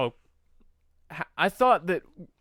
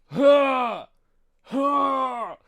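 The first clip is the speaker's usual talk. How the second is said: loud, high exclaimed "ha"; big shouted mock outburst; Drax's noise; 10 semitones higher, 8 dB louder than usual. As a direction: imitate Drax with a sudden loud burst. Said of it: "That is the noise I make".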